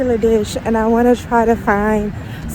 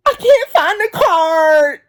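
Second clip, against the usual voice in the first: put-on high, slow whiny kid; 8 semitones higher, 4 dB louder, slower than usual. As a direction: whine the complaint like a little boy. Nephew whining about the card.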